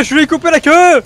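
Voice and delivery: crowing about the cut tail; triumphant shout. Real, not acted. Triumphant repeat, louder.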